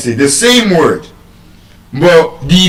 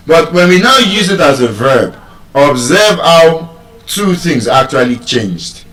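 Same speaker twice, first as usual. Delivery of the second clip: ordinary talk, nothing marked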